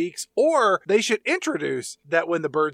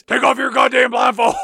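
loud, higher snarling yell; mean mountain man shout; 8 semitones higher, 9 dB louder than usual. Acted. Mountain man grabbing Sandra Bullock's arm, furious shout. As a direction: bark the order like a crazed mountain man. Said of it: "he turns real mean real fast".

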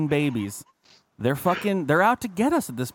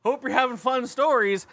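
higher, slow put-upon whine; mopey lonely friend; 6 semitones higher, slower than usual. Retold or imitated. Friend Travis seated alone, mock pitiful whine. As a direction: play the friend left alone at a table. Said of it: "this motherfucker's just sitting there like".

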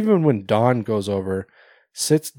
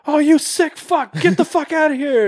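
high, loud mock yelling at the customer; 4 dB louder than usual. Pizzeria guy yelling at Art in the bathroom, hammed-up yell. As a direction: yell him out of the shop, hammed up. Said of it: "you can hear him yelling".

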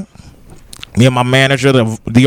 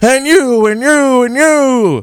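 put-on stilted old movie melodrama; 8 semitones higher, slower than usual. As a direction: point at each one like an old movie hero. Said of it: "bad, nineteen fifties acting".

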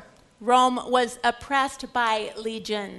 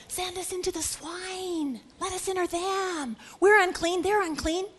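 demons bargaining, pitched up; pleading demons; 6 semitones higher than usual. Acted. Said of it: "They pleaded with him".

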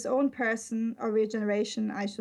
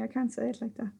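ordinary delivery

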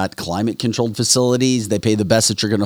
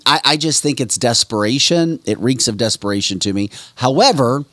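ordinary delivery

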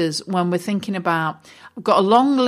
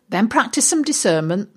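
ordinary delivery

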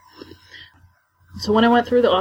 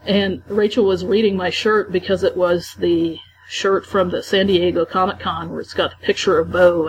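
normal talking voice

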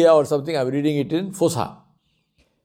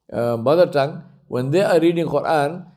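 ordinary talk, nothing marked

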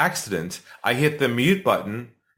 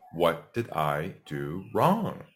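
much softer and slow, lost; stunned self-question; 7 semitones lower, 7 dB quieter, much slower than usual. Retold or imitated. Seller after losing the deal, slow and hushed. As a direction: ask it to the wall, deflated and drawn out. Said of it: "I said to myself".

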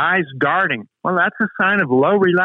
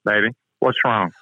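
mother's gentle concern; 6 semitones lower than usual. Retold or imitated. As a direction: coax it out of him like a caring mother. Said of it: "She goes".

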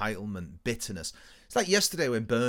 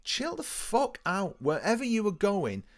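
exasperated plea; 8 semitones higher, slower than usual. Retold or imitated. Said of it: "it's just like".

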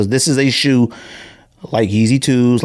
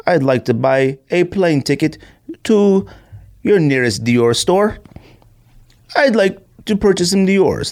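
hammed-up English accent, pitched higher; mock posh English accent; 7 semitones higher than usual. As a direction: play a pompous Englishman buying a plane ticket in an English accent. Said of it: "I use my English accent when I'm doing something important".

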